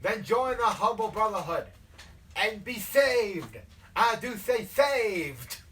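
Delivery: loud southern altar call, slowing to a stretch; southern hellfire preacher. Acted. Leader demanding the recruit be saved, loud southern preaching. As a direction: belt out salvation like a revivalist. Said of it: "like, the hellfire and brimstone thing".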